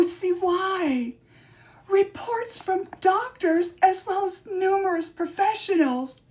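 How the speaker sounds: mock tearful, wailing witch; fake crying. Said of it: "I need an Oscar".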